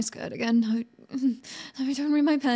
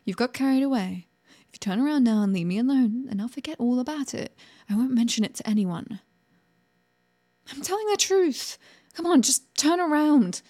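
usual speaking voice

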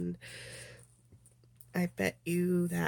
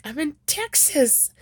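lightly acted, higher-pitched film line; movie character; 8 semitones higher, slower than usual. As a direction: quote the movie hero, pitched up and drawn out. Movie phone call acted out.

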